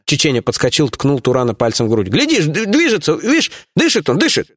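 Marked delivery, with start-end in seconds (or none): 2.1-4.5 high, stammering urgent reassurance; frantic insistence. Acted. Lieutenant pokes the captive to prove life, frantic. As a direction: stumble over words while desperately proving it.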